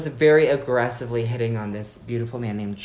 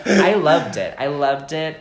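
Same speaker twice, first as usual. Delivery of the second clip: ordinary talk, nothing marked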